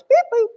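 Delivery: high-pitched, loud machine blips; playful electronic beep and boop; scanner beeps. Pretend. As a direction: mimic a barcode scanner. Imitates her barcode machine.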